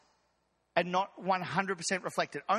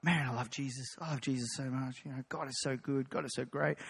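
low, soft inner gushing; lightly voiced private praise, softer; 8 semitones lower, 5 dB quieter than usual. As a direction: murmur devotion to yourself. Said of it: "you're going".

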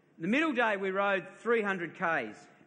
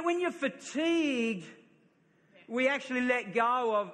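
normal talking voice

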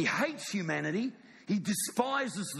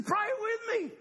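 much higher, anguished plea to pray with him; Jesus crying out. Acted. Jesus in the garden begging his friends, high anguished cry.

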